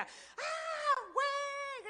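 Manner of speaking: high, sudden cry of delight; joyful whoop. Acted. Pastor acting out a lottery winner, wild high whoop. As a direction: burst out like you just won a car. Winning a car, jumping for joy.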